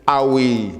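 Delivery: ordinary talk, nothing marked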